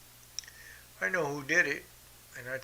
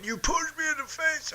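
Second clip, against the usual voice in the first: high, shrill imitated scream; mock scream; 4 dB louder than usual. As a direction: ham up a screaming brother-in-law. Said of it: "comes out there screaming".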